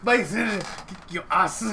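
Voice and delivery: loud, slow mock Van Damme line; Dutch accent. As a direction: drag out kick your ass in a Dutch accent. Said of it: "The way he said".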